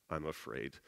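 usual speaking voice

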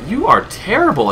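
high, loud taunt; shouting. Real, not acted. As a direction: yell at the monster. Yelling at monster.

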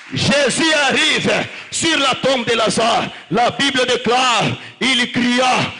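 loud, driving sermon delivery, French and Swahili; fiery preaching. Acted. Preacher rousing the congregation to rise, shouting. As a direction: preach it to the crowd at full force.

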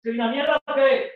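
put-on high, furious challenge at the boyfriend; escalating angry shout. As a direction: build from loud to screaming. Same question escalates to a shout.

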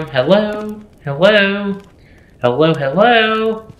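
high, slow calling into the dark. Real, not acted. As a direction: call out nervously to nobody. Calling out into empty house.

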